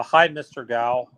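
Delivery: normal talking voice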